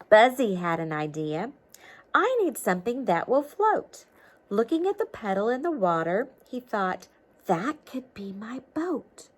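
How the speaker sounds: lively storybook read-aloud; reading to children. A narrator's reading. Teacher reading a storybook to children, lively read-aloud. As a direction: read it like a picture book to kids.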